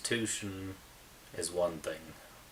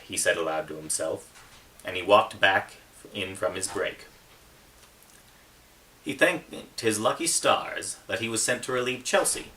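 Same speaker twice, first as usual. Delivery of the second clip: steady, louder reading voice, building toward the fire scene; story narration; 3 semitones higher, 8 dB louder than usual. A narrator's reading. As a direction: read it like an audiobook that grows tense. Author reads his novel aloud.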